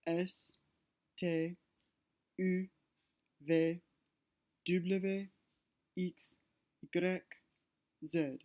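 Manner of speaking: reciting the French alphabet letter by letter; French letter names. Shown as a demonstration. Teacher reciting the alphabet to students, French letter names. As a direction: spell out each letter the French way. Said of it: "look at their pronunciation".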